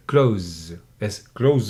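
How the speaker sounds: "clothes" without th, just like the verb "close"; spoken-English pronunciation. Shown as a demonstration. Teacher shows casual form, "clothes" said like "close". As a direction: drop the th in "clothes", ending on a long z. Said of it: "do not pronounce th sound".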